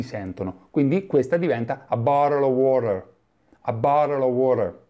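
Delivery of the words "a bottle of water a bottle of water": "a bottle of water" with flapped T and strong R, like "barel o worer"; "a bottle of water" in American English, T turned to a flap. Shown as a demonstration. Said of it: "in American English la R ... si pronuncia".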